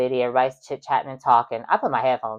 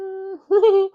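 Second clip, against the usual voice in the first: scoffing laugh; 5 dB louder than usual. Real, not acted. After outrage.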